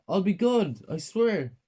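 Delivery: high, loud desperate promise; pleading child. A narrator's reading. Ted promising his mother to behave, pleading.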